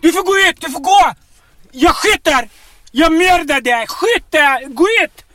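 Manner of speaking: screaming high orders to get out; mock threat. Driver pretending to hijack the car, shrieking high threats at his passenger. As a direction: bark it like a panicked gunman, very high and loud. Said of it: "Nu hotar jag".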